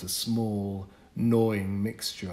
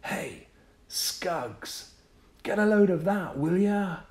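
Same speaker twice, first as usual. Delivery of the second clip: put-on high, loud boyish voice; a bragging boy showing off a comic; kid's call; 10 semitones higher, 4 dB louder than usual. Boy in the store calls his friend, bragging and loud.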